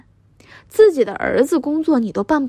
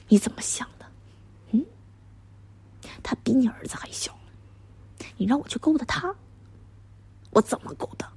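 soft, whispery questioning; hushed disbelief; 9 dB quieter than usual. Acted. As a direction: confront him so the driver can't hear. Hushed argument within the driver's earshot. (压着嗓子低声质问)

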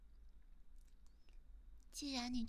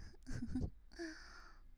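soft giggle; 5 semitones higher, 4 dB quieter than usual. Real, not acted. Giggles between curses.

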